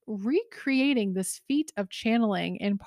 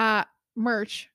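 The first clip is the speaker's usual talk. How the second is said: normal talking voice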